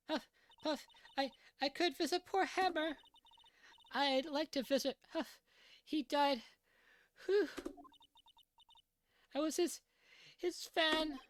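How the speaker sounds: put-on high old woman voice, huffing between words; huffing old lady. Old lady panting out her story about the boy. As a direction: puff and pant through every phrase.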